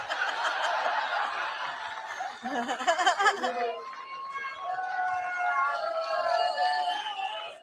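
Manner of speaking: crowd-pleasing long laugh; big laugh. Real, not acted. Candidate laughs at his broken teleprompter, big laugh.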